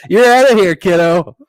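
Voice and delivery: loud, high comic arrest line; hammed-up lawwoman throwing someone out; mock tough cop. Kay cuffing Sophia, loud mock tough cop. Voices FBI agent's daughter making arrest.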